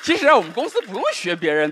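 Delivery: usual speaking voice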